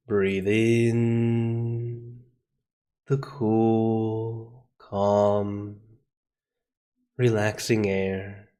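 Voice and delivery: slow, drawn-out meditation voice with long pauses; guided meditation. Acted. Teacher guiding the class into calm breathing, slow meditation voice. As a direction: ease them gently into breathing, slowly.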